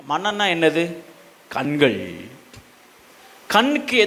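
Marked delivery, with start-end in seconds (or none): none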